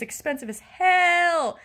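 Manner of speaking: real frustration, stretched out slowly; exasperated venting. Real, not acted. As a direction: draw it out in frustration. Venting about cost, first take.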